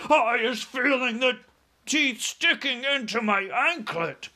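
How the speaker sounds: high, loud giant's wail; panicked yell. A narrator's reading. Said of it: "he yelled".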